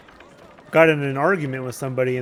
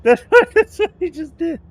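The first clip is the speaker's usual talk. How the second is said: much higher, much louder, squeaking through laughter; squealing laugh; 14 semitones higher, 9 dB louder than usual. Real, not acted. Streamer cracks up at own game character, squealing with laughter. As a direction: shriek the words out mid-laugh.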